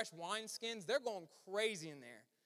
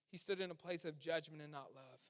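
ordinary talk

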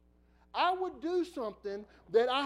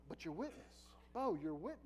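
quiet gentle reminder; soft sweet voice; 4 semitones lower, 7 dB quieter than usual. Retold or imitated. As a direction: softly nudge him to calm. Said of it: "she's just going".

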